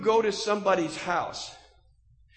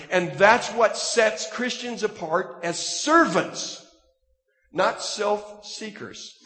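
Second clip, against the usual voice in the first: louder, driving pulpit delivery; full-force preaching; 3 semitones higher, 6 dB louder than usual. Acted. Preacher exhorting the congregation about work, full force.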